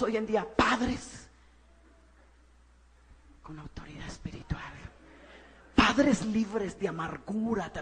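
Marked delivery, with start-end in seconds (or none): 3.4-4.6 hushed emphasis. Acted. Emphatic sermon point.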